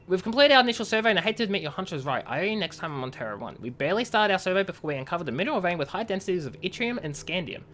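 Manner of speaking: lightly voiced in-character message read; reading game messages. Streamer reads a miner's report to chat, lightly acted. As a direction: read the colony log like a letter home.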